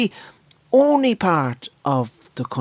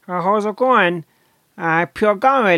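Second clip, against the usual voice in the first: thin, higher Galway accent; 7 semitones higher than usual. Shown as a demonstration. Comic showing the thinness of Galway speech, high Galway accent. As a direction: greet someone in pure Galway. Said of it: "The Galway accent is kinda".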